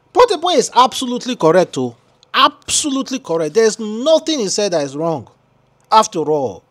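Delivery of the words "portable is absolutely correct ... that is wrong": high mock agreement, dripping irony; exaggerated sarcastic praise. Real, not acted. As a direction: pretend to agree, but mean the opposite.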